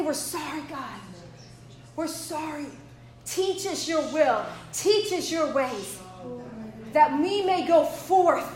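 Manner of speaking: soft, low, pleading prayer; humble prayer. Acted. Preacher telling God we're sorry, humble hushed prayer.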